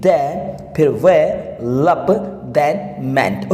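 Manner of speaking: each part "दे", "वे", "लप", "मेंट" said alone between pauses; syllable by syllable. Shown as a demonstration. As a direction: count out "दे", "वे", "लप", "मेंट" as four separate beats. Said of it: "four time में आप इसको बोलेंगे". ("दे", "वे", "लप", "मेंट" अलग-अलग, रुककर बोलें)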